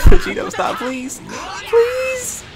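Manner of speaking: breathy giggling through the plea; laughing plea. Real, not acted. Laughing as he begs Vegito to stop mid-match. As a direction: beg the character to stop through helpless laughter.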